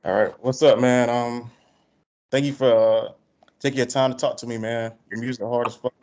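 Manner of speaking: normal talking voice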